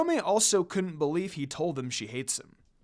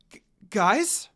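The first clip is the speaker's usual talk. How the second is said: surprised gasp; 3 semitones higher, 5 dB louder than usual. A narrator's reading. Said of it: "Kagome gasped in surprise".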